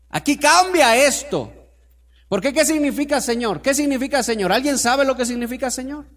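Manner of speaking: loud, high preaching at full force; fervent preaching. Real, not acted. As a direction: drive the story home to the congregation. (predicación ferviente y a todo volumen)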